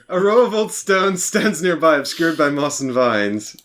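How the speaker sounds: slow storyteller reading; scene narration. Acted. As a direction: set the scene slowly. Reads game scene text.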